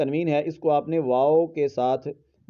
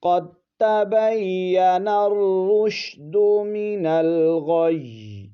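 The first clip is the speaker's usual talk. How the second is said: slow melodic chant, higher; Quranic recitation; 4 semitones higher, 3 dB louder, much slower than usual. Shown as a demonstration. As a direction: draw out the verse tunefully. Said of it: "اس طرح پڑھیں گے اس لفظ کو".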